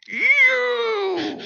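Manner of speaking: mock-outraged squeal, very high and drawn out; livid outburst. Livid woman spotting the pastor in her kitchen, hammed-up outrage. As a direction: act out a high-pitched, accusing shriek. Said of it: "Just like that".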